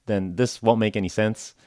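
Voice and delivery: ordinary talk, nothing marked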